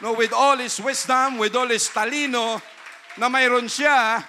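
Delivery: preacher at full force to congregation; full-force preaching. Acted. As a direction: preach it with fire.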